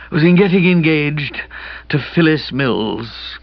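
drawn-out confession of a catarrhal ghost; slow down into a hollow, husky wail; husky ghostly voice. A narrator's reading. Kipper confesses the engagement, husky ghostly voice. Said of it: "as if he'd been a ghost suffering from catarrh".

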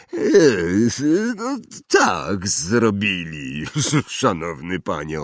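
slow, awkward giant's admission; gruff giant, sheepish. Acted. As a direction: own up haltingly and respectfully in a big voice. (zakłopotany olbrzym, powoli i niezgrabnie)

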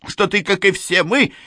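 plain reading voice

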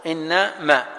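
إنما in two parts, "inna", pause, "ma"; said as separated. Shown as a demonstration. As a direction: leave a gap inside إنما before ma. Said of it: "مفصولة".

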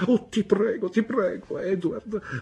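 high, begging through tears; tearful pleading. A narrator's reading. Young wife begging her husband, tearful and high. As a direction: plead with him, near breaking. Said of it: "pianse mia madre".

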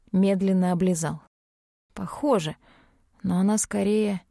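ordinary delivery, nothing marked

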